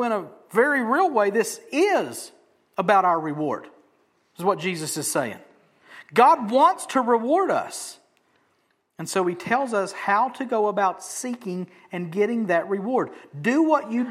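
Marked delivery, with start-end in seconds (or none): none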